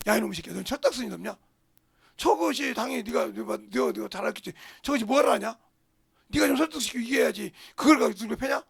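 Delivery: put-on loud scolding of the older child; mock scolding. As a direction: tear into the college kid. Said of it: "이러고 욕하지".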